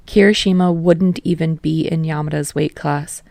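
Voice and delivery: usual speaking voice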